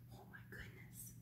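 breathy secret whisper; hushed gasped whisper. Real, not acted. Same words whispered to children.

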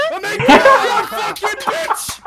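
laughing shout. Real, not acted. Trash talk, laughing.

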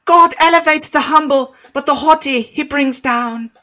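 very high, loud put-on voice; mock him in a shrill falsetto; a high, loud, scornful falsetto; mocking queen voice. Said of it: "She laughed at him, saying".

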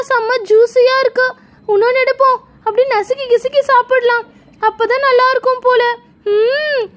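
put-on high-pitched little girl voice; gleeful mischievous girl. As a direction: play a naughty child relishing stolen cherries. (குறும்புக்கார சின்னப் பொண்ணு குரல்)

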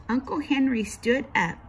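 ordinary delivery, nothing marked

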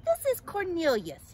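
high, loud caterpillar introduction; Cornelius. A narrator's reading. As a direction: announce yourself brightly to someone shut inside. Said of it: "he said".

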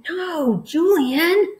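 high, urgent half-scream held back; whisper scream. Acted. Jack stopping Julian before the teacher hears, whisper screamed. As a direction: yell at him without letting the teacher hear. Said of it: "I whisper screamed".

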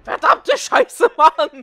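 high, loud, slow furious shout. Real, not acted. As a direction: yell it out in rage. Character dies in game.

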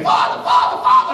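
high, urgent mock cry for Father; excited altar boy. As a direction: call out frantically like a child running in.

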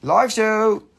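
high, loud delighted gasp; mock thrilled exclamation; excited surprise. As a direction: react like a happy discovery. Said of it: "I was like".

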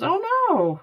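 high, loud "oh no" swoop; mock-dismayed and high-pitched; mimicked friend's dismay. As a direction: imitate a friend's exaggerated concern. Mimics friend's reaction to diagnosis.

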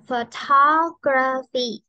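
photography slow and loud with the weight on TO; stress on second syllable. Shown as a demonstration. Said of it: "Nhấn âm hai nhá". (nhấn âm thứ hai trong photography, đọc chậm)

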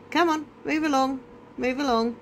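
high-pitched, playful urging; coaxing high voice. Real, not acted. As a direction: coax it along in a high voice. Urging a slow progress bar.

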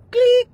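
loud high click sound; phone click. Pretend. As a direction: snap out a click. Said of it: "when I do the phone calls".

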